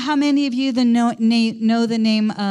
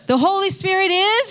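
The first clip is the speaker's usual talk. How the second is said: call for response; 7 semitones higher than usual. Real, not acted. Prompts congregation to answer.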